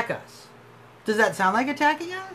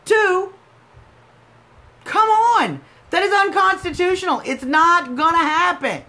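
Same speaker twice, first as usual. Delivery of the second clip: much higher and louder, fed up; exasperated outburst; 8 semitones higher, 5 dB louder than usual. Real, not acted. Telling off a caller, exasperated and loud. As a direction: throw up your hands at the absurd claim.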